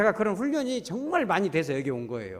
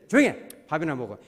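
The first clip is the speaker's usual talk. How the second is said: harsh shutdown; 6 dB louder than usual. Retold or imitated. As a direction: snap at her to shut up and eat. Husband silencing complaining wife.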